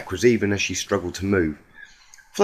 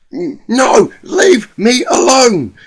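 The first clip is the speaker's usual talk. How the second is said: much higher, louder, slowly drawn-out plea; terrified cry; 13 semitones higher, 10 dB louder, much slower than usual. Acted. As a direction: wail it to be left alone. Rainbow Dash screaming refusal.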